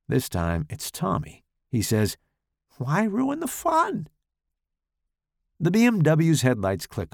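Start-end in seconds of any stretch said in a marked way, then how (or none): 2.8-4.1 high teasing voice. Acted. Tommy voice egging on.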